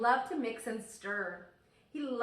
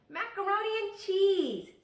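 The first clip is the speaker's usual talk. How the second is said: higher, louder, delighted; eager little sister; 11 semitones higher, 8 dB louder than usual. Acted. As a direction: blurt it happily like a small girl.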